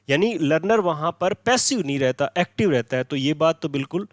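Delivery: ordinary talk, nothing marked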